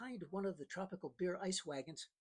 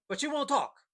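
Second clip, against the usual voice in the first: sudden high, loud threat; menacing outburst; 10 semitones higher, 12 dB louder than usual. A narrator's reading. Pancho threatening the captain who refuses, loud and high. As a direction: snap it out as a warning.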